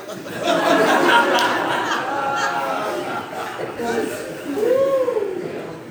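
long high laughing fit. Real, not acted. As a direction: burst out laughing at the memory. Laughing at own story.

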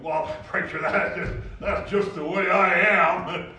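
loud, slow, defiant shouting; angry man's excuse. Retold or imitated. Angry man shouting his excuse at the counseling preacher. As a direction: bellow it like a hothead brushing off advice. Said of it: "a furious and angry man".

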